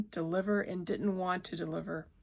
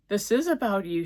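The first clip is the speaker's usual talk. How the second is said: higher, louder protest; 3 semitones higher, 9 dB louder than usual. A narrator's reading. Woman protesting to her lover, throwing his line back. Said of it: "she protested".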